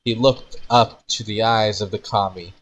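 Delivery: usual speaking voice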